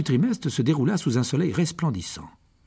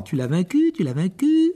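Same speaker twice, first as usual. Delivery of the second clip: higher, rising jubilant call; excited cry; 7 semitones higher than usual. Acted. Hermione running to Harry after his victory, excited cry. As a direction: cry it out, climbing on the repeat. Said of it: "courant vers lui et criant".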